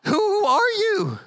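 high, shrill-edged cry of panicked fishermen; terrified disciples. Retold or imitated. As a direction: squeal it out like you just saw a ghost. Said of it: "They're like".

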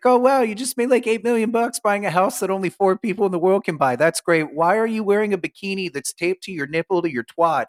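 high mock gushing voice; sarcastic high mimicry of the TV host; mocking the reality show. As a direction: gush over them in a high sarcastic voice. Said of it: "it's fun 'cause it's like".

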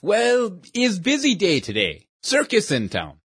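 lightly voiced old shopkeeper with a broken-English accent; a kindly old immigrant magician in broken English. Shopkeeper sends boy off.

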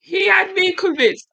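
high, slow and thrilled; excited high voice. Real, not acted. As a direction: gush about the speech. Enthusiastic about orc speech.

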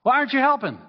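high, loud complaining yell; child shouting to tell on a sibling; tattling shout. Pretend. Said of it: "No, it's like".

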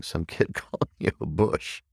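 amused, laugh breaking into the words; laughing while talking. Real, not acted. Speaker recalls kid calling you bush, laughing. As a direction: laugh through the line.

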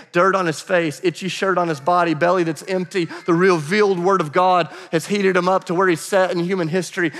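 ordinary talk

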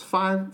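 high, punched five; emphatic five. Shown as a demonstration. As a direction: hit five hard. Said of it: "not five to ten years".

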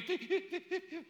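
high, quiet beeping by mouth; imitated monitor beeps. Preacher picturing listeners' deaths, imitated monitor beeps. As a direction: mimic a hospital heart monitor.

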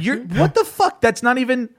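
imitating his own disbelieving rant at a commenter; worked-up retort to a rude commenter; exasperated outburst. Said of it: "now I'm even more pissed".

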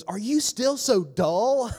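high, slow, sassy and exasperated; holy sass. Retold or imitated. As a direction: drag it out with sassy disbelief. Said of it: "Jesus had a moment of holy sass".

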